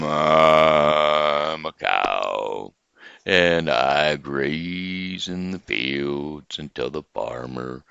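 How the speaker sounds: low, very slow drawn-out cow; a low, slow cow answering the frog. Acted. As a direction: moo the words out lazily.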